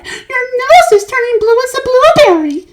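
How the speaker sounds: horrified mother screaming. A narrator's reading. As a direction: scream it in horror. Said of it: "screamed Missus Beauregard".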